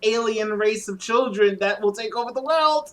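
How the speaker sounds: high-pitched, hammed-up paranoia; mock panic. Playing paranoid Lois fearing alien children, hammed-up panic. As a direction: spiral upward into frantic alarm.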